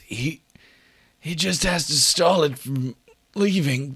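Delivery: high strained wounded voice; injured ally. Acted. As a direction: urge them on despite broken ribs.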